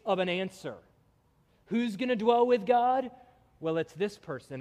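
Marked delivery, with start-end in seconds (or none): 1.7-3.1 higher and louder, calling out the question; ringing question. Acted. Preacher asking the congregation, raised and ringing. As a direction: lift the voice and call it to the room.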